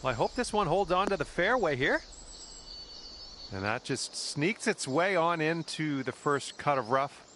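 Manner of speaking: calm golf broadcast voice; golf commentator. Acted. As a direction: call the shots like TV golf. Golf game commentary throughout.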